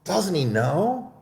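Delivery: high, slow, pleased mouse voice; sly mouse chuckling to itself; smug little mouse. Acted. Mouse mocks the fled owl.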